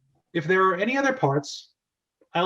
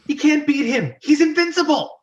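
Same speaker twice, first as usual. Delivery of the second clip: much higher, louder, frantic; a small creature in panic; squeaky panicked Toad; 11 semitones higher, 6 dB louder than usual. Acted. Toad warning Mario about Koopa, high and frantic.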